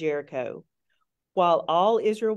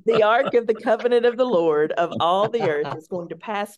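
reading The ark through laughter; laughing; 3 semitones higher, 5 dB louder than usual. Real, not acted. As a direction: giggle through the ark of the covenant.

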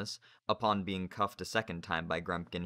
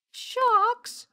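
terrified squeal; 11 dB louder than usual. Acted. James terrified.

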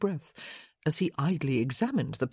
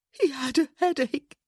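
high, tearful whimper; 9 semitones higher, 3 dB louder than usual. A narrator's reading. Wife collapsed in friend's arms, whimpering. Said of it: "she whimpered".

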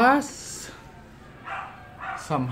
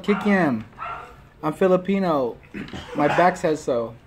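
talking while chewing food; words muffled by food; mouth full, chewing; faster than usual. Real, not acted. Seen 2: bit kikiam at -1; lips closed, cheeks full while talking.

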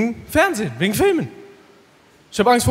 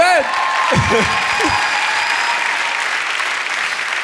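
breathless giggle; 7 semitones higher than usual. Real, not acted. Cracks up mid-hype.

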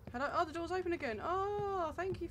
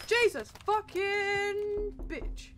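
a slow, much higher, louder scream; startled shout; 10 semitones higher, 10 dB louder, much slower than usual. Real, not acted. Scared player screams at a jump scare, shouting.

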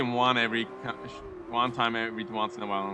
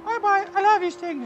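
squeaky mock goodbye; 8 dB louder than usual. Taunting the beaten rival.

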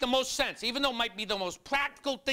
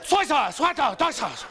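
high, loud kitchen calls; calling out sauces; 5 semitones higher, 4 dB louder, much slower than usual. Retold or imitated. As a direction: shout the sauces like a cook downstairs. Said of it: "There's a guy downstairs going".

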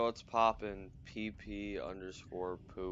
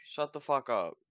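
angry; 5 semitones higher, 6 dB louder than usual. Real, not acted. Yelling at teasing friend.

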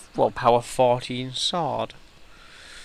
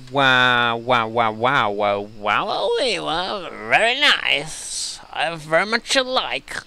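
excited; 2 semitones higher, 7 dB louder, slower than usual. Real, not acted. Excited over new item.

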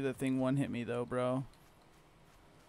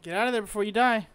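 urgent; 7 semitones higher, 7 dB louder, faster than usual. Real, not acted. Urging friend to flee.

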